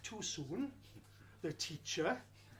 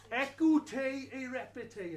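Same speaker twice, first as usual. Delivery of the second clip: mock French language-tape voice, higher and louder; French accent; 5 semitones higher, 5 dB louder than usual. Language lab tape instructs the pupils, in a French accent. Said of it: "in a French accent".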